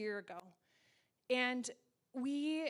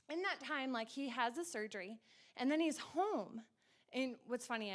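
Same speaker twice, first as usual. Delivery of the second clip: ordinary talk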